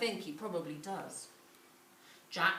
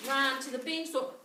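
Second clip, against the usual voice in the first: ordinary delivery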